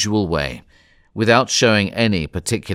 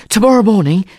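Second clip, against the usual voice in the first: high, loud, panting urgency; out of breath; 11 semitones higher, 6 dB louder, faster than usual. A narrator's reading. Passepartout back from the Reverend's house, out of breath. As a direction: gasp out the news after running. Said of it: "he said out of breath".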